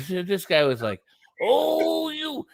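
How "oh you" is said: high, drawn-out mock Australian drawl; Australian impression. Imitating a live-stream guest from Australia, mock Australian accent. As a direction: put on a mock Aussie whine. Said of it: "he was from Australia".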